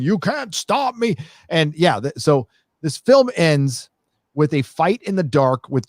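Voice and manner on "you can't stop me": Pedro Pascal screaming. Retold or imitated. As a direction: imitate a drawn-out scream without the volume. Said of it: "Pedro Pascal was screaming".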